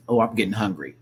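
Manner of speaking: ordinary delivery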